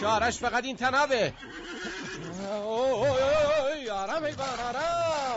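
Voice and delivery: loud, high strained calls while struggling; straining shout. Acted. Grappling with the panicked horse, shouting at it to calm down. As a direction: fight to hold the horse, yelling to calm it.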